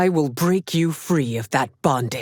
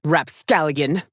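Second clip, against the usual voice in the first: ordinary talk, nothing marked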